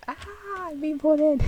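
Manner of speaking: high, loud, swooning. Retold or imitated. Fan voices being pulled in by the gaze, high and swooning. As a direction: act helplessly drawn in.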